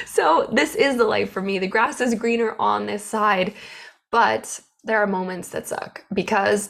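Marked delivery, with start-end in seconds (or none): none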